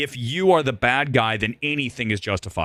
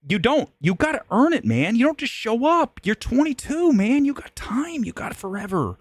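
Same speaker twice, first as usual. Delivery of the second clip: much higher, exasperated scolding; heated lecture; 13 semitones higher than usual. Real, not acted. Lecturing kids, pitch very high.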